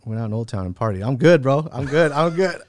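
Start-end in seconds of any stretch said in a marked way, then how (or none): 1.1-2.6 loud, raised-pitch repeated brush-off; mock carefree insistence. Partier waving off his near-death scare, mock carefree insistence. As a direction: mimic your old self insisting loudly.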